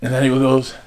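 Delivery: ordinary delivery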